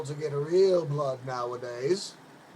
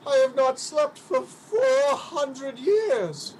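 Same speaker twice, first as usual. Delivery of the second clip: slow, higher plaintive cry; a lonely ghost pleading with the girl; tearful lament; 6 semitones higher, 3 dB louder, slower than usual. Acted. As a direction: mourn your endless sleeplessness.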